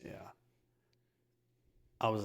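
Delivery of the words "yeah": usual speaking voice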